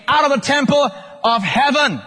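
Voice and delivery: building proclamation. Acted. Preacher reading of the voice from heaven, proclaiming.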